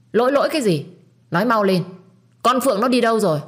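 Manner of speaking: loud, high impatient scolding; angry demand. Acted. Grandmother demanding answers from Linh, loud angry scolding. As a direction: bark the questions, furious.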